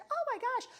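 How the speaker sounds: high, loud gasp of surprise; acting out a shocked acquaintance; mock surprise. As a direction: imitate her startled oh my gosh. Retold surprised acquaintance in store.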